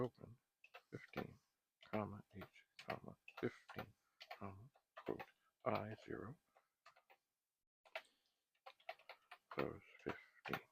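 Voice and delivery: quietly dictating while typing. Shown as a demonstration. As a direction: spell out each symbol quietly. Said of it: "Let's try that again".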